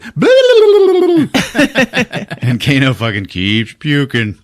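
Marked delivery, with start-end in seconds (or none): 0.0-2.2 much higher, louder mocking blah-blah, slowing at the end; mock babbling. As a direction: taunt him with silly high babble. Mocking babble in his face.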